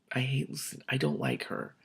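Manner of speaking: ordinary delivery, nothing marked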